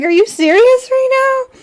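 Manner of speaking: outraged disbelief at the boss; incredulous. Retold or imitated. Retelling her outrage at management.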